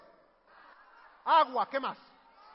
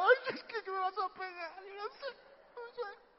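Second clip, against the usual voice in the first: put-on whimpering child; 10 semitones higher than usual. Child afraid of being hit, mock whimpering. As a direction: whimper like a child expecting a smack. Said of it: "Ya empezamos a llorar".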